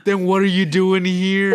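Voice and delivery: hammed-up disbelief, slow; mock shocked. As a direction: play stupidly amazed. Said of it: "They were so shocked".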